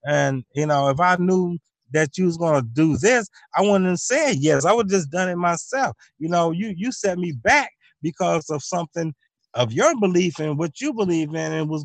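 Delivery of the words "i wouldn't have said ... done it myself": ordinary delivery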